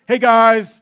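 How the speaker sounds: imitating a muscly trainer, high and loud; loud, hammed-up gym-guy greeting; Jeremy. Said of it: "That's how he talks".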